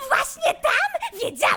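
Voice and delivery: excited laughing shout. Acted. Excited discovery of monsters.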